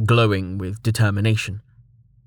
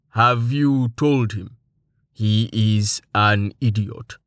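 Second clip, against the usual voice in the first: slow, even, deadpan giant voice; stoic Tallheart; slower than usual. A narrator's reading. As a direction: ask flatly, almost bored. Tallheart speaks to Amelia.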